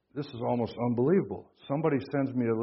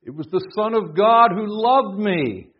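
slow, ringing proclamation with "loved" held out; stress on "loved"; 8 semitones higher, 9 dB louder, slower than usual. Shown as a demonstration. Preacher re-reading a verse with new emphasis, stress on "loved". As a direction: push up loud and high through "loved me". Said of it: "where you put the emphasis".

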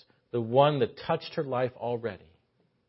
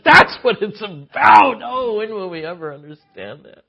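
crying; 9 semitones higher, 15 dB louder than usual. Real, not acted. Preacher overcome in climax.

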